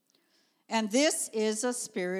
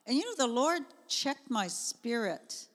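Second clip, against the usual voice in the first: normal talking voice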